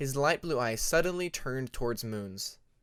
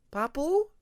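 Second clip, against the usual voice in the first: put-on very high, louder child voice asking again; worried little child; 14 semitones higher, 6 dB louder than usual. Bobcat the baby dragon calling his frozen father. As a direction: call a father anxiously as a little one.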